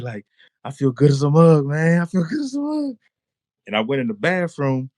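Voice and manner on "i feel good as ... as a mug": high exclamation climbing higher; elated. Retold or imitated. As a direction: re-enact bursting with joy, pitch rising. Elated on edge of bed.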